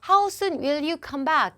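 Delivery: How soon will you come back? read out very slowly; a teacher reading it out slowly; slow English demo. Shown as a demonstration. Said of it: "그래서 보세요".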